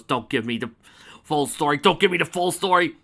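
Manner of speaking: loud desperate plea to stop; pleading yell. Real, not acted. Player yelling at the GM to stop the story, loud pleading. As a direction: shout him down before he starts.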